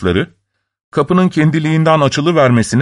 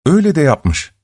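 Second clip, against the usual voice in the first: plain reading voice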